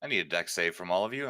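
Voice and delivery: ordinary delivery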